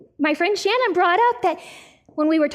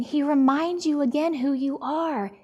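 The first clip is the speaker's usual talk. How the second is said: ordinary delivery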